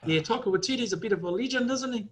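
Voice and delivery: put-on high Kiwi accent, cheeky and playful; Taika Waititi impression. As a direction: play the laid-back New Zealand director. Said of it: "that's my guy right there".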